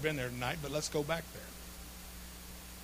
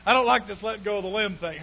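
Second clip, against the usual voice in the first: normal talking voice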